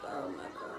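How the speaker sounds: soft whispered oh my God; stunned whisper. Retold or imitated. Told she's in labor, stunned soft whisper. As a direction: breathe it out in disbelief. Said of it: "So I'm just like".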